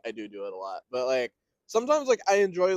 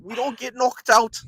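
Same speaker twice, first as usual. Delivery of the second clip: loud, higher, slow Scouse with hard k; Scouse accent; 7 semitones higher, 8 dB louder, slower than usual. Shown as a demonstration. Showing friend the Scouse k, loud exaggerated accent. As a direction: punch the k in "knocked" Scouse-style. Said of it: "it's like a k".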